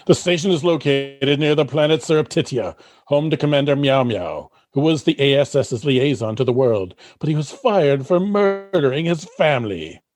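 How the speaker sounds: usual speaking voice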